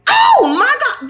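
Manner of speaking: high, slow shocked gasp of words; stunned reaction to a viewer's comment. Real, not acted. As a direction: let the shock stretch out the words.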